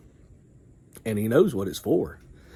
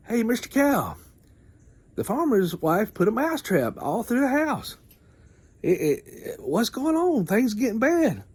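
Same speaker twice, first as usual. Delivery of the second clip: high squeaky mouse voice, stammering; tiny mouse in a panic; frantic little mouse; 11 semitones higher than usual. Retold or imitated. Mouse begging the cow, high squeaky voice.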